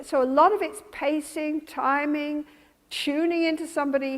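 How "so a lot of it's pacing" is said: ordinary talk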